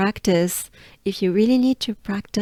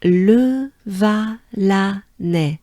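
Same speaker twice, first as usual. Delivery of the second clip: Le Valanais drawn out slowly, each syllable clear; slow model pronunciation for learners; slow demo; 4 dB louder, much slower than usual. Shown as a demonstration. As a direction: stretch out Le Valanais like a teacher. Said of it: "it goes like this".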